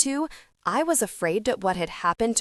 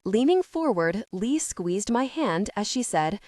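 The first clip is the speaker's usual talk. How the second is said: usual speaking voice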